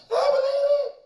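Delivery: very high and loud, wailing through tears; sobbing cry. Retold or imitated. Teen sobbing at Doctor King's grave, high loud wail. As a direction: let the words break into a wail. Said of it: "He's crying".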